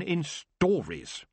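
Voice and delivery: slow, high, scornful STORIES; contemptuous. A narrator's reading. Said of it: "said the last word contemptuously".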